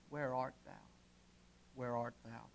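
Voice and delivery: soft, low, near-whispered asking; hushed divine question. Pretend. God asking the hearer quietly, hushed. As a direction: breathe the question to them.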